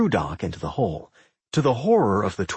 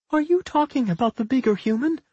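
high little pup voice; small creature asking, pitched way up; talking pup; 13 semitones higher than usual. A narrator's reading. Pup asks Millie about her father, high creature voice. Pup speaks in human speech.

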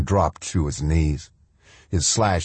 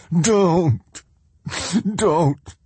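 sobbed; 12 semitones higher than usual. Acted. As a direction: break down begging him to stop. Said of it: "the other man sobbed".

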